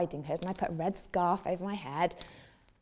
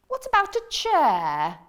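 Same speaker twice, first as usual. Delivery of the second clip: high, louder hopeful question; hopeful frog; 8 semitones higher, 7 dB louder than usual. Acted. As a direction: ask brightly hoping for yes. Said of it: "said the frog".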